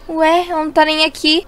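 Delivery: ordinary talk, nothing marked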